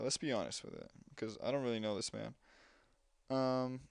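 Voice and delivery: normal talking voice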